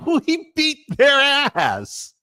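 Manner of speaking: high, slow, gleeful boast; high drawn-out gloat; triumphant boast. Real, not acted. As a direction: savor the win.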